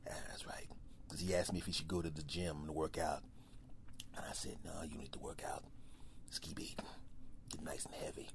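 low, fast whisper; secret thoughts. Acted. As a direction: whisper a sneaky plan. Bear whispering plan.

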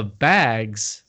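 "bags" said as "baygs"; long AY vowel in "bags", drawn out; Minnesotan vowel in bags. Retold or imitated. Imitating orientation manager, "bags" said like "baygs". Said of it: "she called the bags bags".

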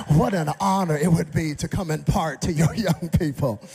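loud, pompous acceptance; mock grandiose. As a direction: play the big-shot preacher. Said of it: "So I said to Adam McCain".

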